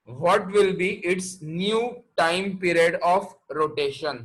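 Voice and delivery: very slow, word by word, question read aloud; slow dictation. Shown as a demonstration. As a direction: spell the question out slowly for the class. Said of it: "question पढ़ रहा हूं मैं फिर से एक बार". (धीरे-धीरे, एक-एक शब्द पढ़ते हुए)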